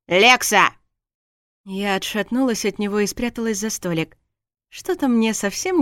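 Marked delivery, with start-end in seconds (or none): 0.1-0.7 short, loud exclamation of her name; exasperated call. Acted. Exasperated husband calls out his tipsy wife's name, loud. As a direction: call her to order, loudly.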